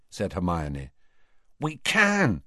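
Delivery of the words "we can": excited. A narrator's reading. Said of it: "said Ron excitedly".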